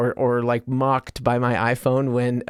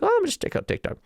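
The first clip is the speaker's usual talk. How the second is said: mock casual thought; much faster than usual. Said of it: "you're like".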